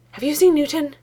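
high, loud and out of breath; panting, urgent question; breathless urgency. A narrator's reading. Breathless Herman bursts in.

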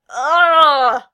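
high, loud "ugh"; loud choked groan of disgust. A narrator's reading. Valoron hears too much about his friend, choking. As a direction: choke out a big revolted cry. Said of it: "Valoron choked".

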